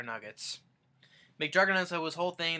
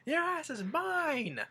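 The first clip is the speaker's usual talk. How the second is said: high, drawn-out angry yell; mock threat; 13 semitones higher, much slower than usual. Grandpa going after Michael, mock-furious threatening yell. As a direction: imitate a furious threat, stretching the last words.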